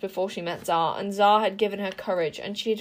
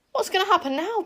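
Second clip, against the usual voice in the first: put-on much higher, fluttering old bird; panicked; 11 semitones higher, faster than usual. A narrator's reading. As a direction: squawk in a panic. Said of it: "panicked Caliburn".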